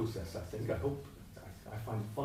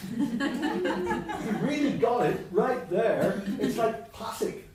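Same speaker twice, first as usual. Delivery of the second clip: laughing; 11 semitones higher, 8 dB louder, much slower than usual. Retold or imitated. Laughing imitation.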